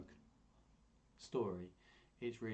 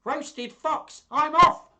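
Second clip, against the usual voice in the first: much higher, much louder frightened squeal; panicked fox; 17 dB louder, much slower than usual. Acted. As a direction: bolt away in terror. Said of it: "Fox said".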